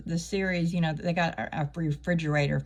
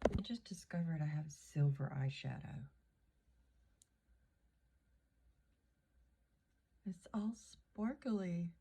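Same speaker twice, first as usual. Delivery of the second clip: whispered, much softer; real whisper; 13 dB quieter than usual. Real, not acted. Whispering to herself about her silver eyeshadow. Noticing makeup on camera, whispering.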